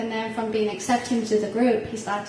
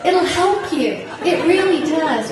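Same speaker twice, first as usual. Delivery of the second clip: quoting her son through laughter, higher and louder; laughing; 6 semitones higher, 9 dB louder than usual. Retold or imitated. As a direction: laugh while repeating the child's advice. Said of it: "He said".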